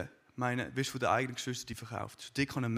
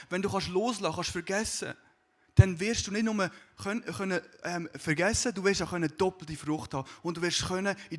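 fervent preaching; 4 semitones higher, 5 dB louder than usual. Acted. Preacher builds up to congregation.

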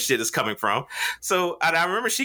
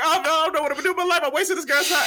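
fake panic attack; 13 semitones higher, much faster than usual. Faking a panic attack to embarrass the lady, frantic mock yelling. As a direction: rattle it off like a full-blown meltdown. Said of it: "just yelling and screaming like I'm having a full-blown panic attack".

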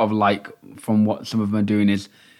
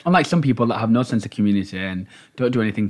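normal talking voice

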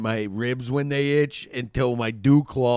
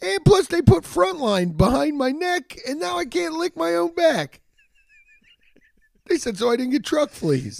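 high whiny voice; 12 semitones higher than usual. Acted. Voices dog-like rant.

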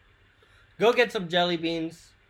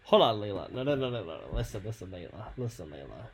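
quiet; 9 semitones lower, 10 dB quieter, faster than usual. Acted. Calming her down in role-play.

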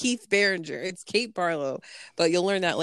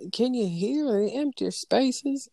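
higher, lilting mock-belle question; Southern belle; 5 semitones higher than usual. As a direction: quote it as the movie's Southern lady. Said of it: "Southern belle".

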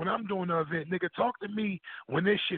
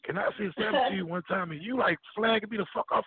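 ordinary delivery

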